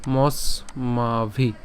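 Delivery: মস্মাভি drawn out very slowly. Shown as a demonstration. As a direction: stretch মস্মাভি syllable by syllable. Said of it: "এরপর হচ্ছে কি হবে". (মস্মাভি খুব ধীরে টেনে)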